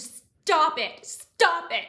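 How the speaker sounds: full-force, high screamed plea; desperate scream. Acted. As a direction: scream it out to make them stop. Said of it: "I screamed".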